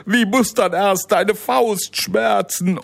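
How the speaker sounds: high, fearful giant cry; a frightened giant calling out. Acted. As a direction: giant crying out as he flees the boy. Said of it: "rief der Riese".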